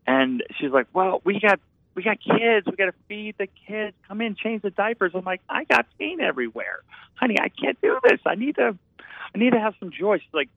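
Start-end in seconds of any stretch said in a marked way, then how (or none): 0.9-5.1 lightly voiced wife, higher pitched and scolding; husband imitating his wife telling him to feed the kids, higher. Wife scolding in retold argument. 5.5-10.2 higher, whiny mock plea; husband reenacting begging his wife to let him paint, higher; pleading husband. Himself pleading in retold argument.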